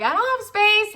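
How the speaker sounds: high, loud imitated excuse; mock complaint. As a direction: whine it as a friend's mock protest. Voices a friend's excuse.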